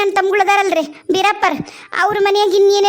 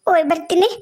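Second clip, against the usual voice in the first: usual speaking voice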